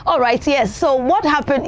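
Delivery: ordinary delivery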